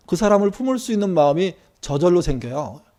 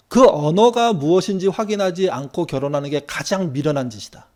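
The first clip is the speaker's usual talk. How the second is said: ordinary talk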